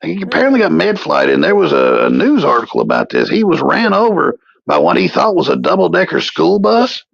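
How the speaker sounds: a put-on voice yelling about the bus; character voice. Character voice, high and wild.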